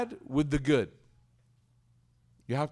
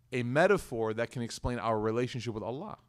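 normal talking voice

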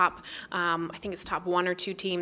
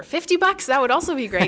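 playful excitement; 7 semitones higher, 9 dB louder than usual. Real, not acted. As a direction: jump way up, louder, joking about big money. Joking about a big donation.

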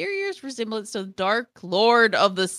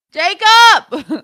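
calling out; 10 semitones higher, 11 dB louder than usual. Real, not acted. Calling husband over excitedly.

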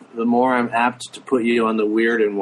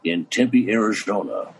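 low, slow talk-show host impression; Johnny Carson; 6 semitones lower than usual. Comedian doing a walk-on at a radio show, Carson impression. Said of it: "See if I can remember Johnny".

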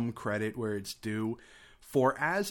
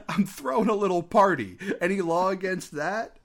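bad acting; 7 semitones higher, 5 dB louder than usual. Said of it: "the line delivery is so bad".

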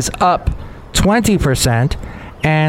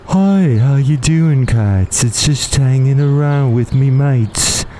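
put-on lazy British accent, slowed down; a chummy English cat greeting his mates; slower than usual. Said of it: "I came up with a voice for him".

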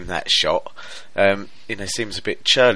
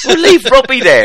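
laughing; 12 dB louder than usual. Real, not acted. Laughing after outburst.

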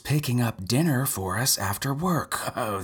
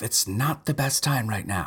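ordinary talk, nothing marked

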